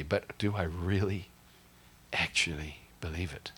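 hushed. Real, not acted. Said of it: "whispered question".

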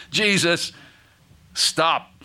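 acted shout for attention; urgent cry. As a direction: call out as if grabbing someone passing by. Acting Bartimaeus wrestling Jesus down.

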